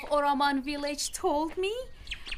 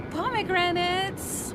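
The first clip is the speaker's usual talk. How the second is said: savoring; 7 semitones higher, slower than usual. Shown as a demonstration. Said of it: "Mm, pomegranates".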